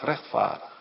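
dropped to a voiceless whisper on rechtvaardig; whispered echo. Acted. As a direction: let rechtvaardig fade to a whisper. Thief admits guilt, said twice. (rechtvaardig zacht nagefluisterd)

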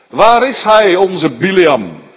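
loud, demanding call with a drawn-out "Biliam"; demanding king. Acted. Balak questions his returning envoys, loudly demanding.

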